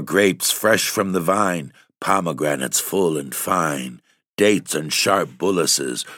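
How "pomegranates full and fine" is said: ordinary delivery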